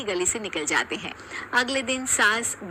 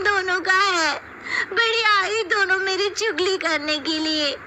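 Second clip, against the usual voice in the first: very high, louder petulant voice, tearful at the end; indignant youngest bahu; 12 semitones higher, 3 dB louder than usual. Acted. Youngest daughter-in-law protesting to mother-in-law, high and petulant. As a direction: insist on equal rights, sulkily.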